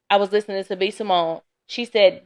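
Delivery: ordinary delivery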